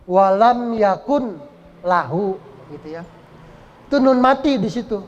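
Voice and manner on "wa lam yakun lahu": "yakun" with a clear final n, apart from "lahu"; as written. Shown as a demonstration. Teacher reading the spelling, "yakun" with audible n. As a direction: slowly separate "yakun" and "lahu", sounding the n. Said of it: "Tulisannya yakun".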